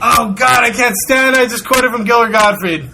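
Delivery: high, loud cry of protest; exasperated outburst. Real, not acted. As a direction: burst out in comic exasperation. Protests at hearing the joke's source.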